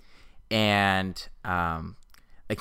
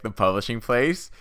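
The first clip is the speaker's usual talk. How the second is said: ordinary talk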